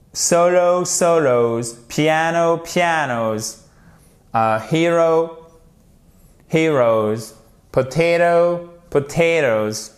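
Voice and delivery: held singular, then plural falling onto a z; slow English word pairs for learners to copy; model pronunciation. Shown as a demonstration. Teacher drills plurals for French learners, demonstrative pronunciation. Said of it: "j'insiste beaucoup sur la prononciation".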